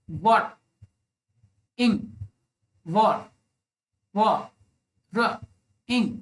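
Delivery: "वर, इंग" broken into isolated sounds with long gaps; syllable by syllable. Shown as a demonstration. Teacher working out the syllables of worrying, syllable by syllable. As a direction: sound out "व, र, इंग" one beat at a time. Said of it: "जिसमें तीन syllable हों".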